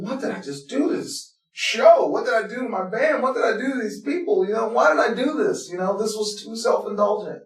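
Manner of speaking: high, loud, anxious rush of questions; panicked self-questioning. Retold or imitated. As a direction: ask yourself in rising panic what you've done.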